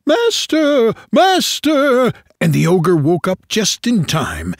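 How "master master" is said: much higher, loud cry; harp calling loud. Acted. The harp calling the ogre as Jack steals it, high and loud. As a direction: cry out high to wake the master. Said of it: "the harp called out quite loud".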